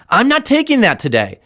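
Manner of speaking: high, emphatic, determined; mean every word of it; a confident coach's affirmation; firm declaration. Shown as a demonstration. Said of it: "Say it to yourself".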